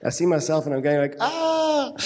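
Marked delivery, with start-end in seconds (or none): 1.2-1.9 high, play-acted shriek without extra volume; hammed-up squeal at the sight of yourself; mock horrified "ah". Preacher imitating reaction to seeing own face, mock horror.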